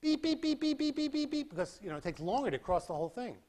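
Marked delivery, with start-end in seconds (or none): 0.0-1.8 high, steady beeps spread out; spaced-out beeps. Shown as a demonstration. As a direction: beep as the scan takes longer to cross. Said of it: "If it goes this way, it goes".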